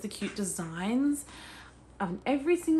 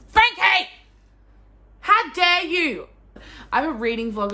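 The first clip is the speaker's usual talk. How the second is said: loud, high-pitched scolding shout; hammed-up offended yelling; mock outrage; 8 semitones higher, 8 dB louder than usual. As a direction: yell in playful indignation at the cousin. Scolding cousin for taking bookmark out.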